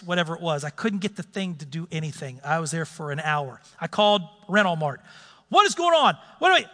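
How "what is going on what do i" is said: frantic. Retold or imitated. Frantic call to rental company.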